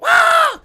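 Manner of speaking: short high, loud yell; crash scream. Retold or imitated. Rider smashing into a car, sudden loud scream. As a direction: cry out as if hitting a car.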